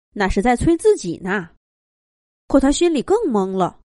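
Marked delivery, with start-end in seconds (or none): none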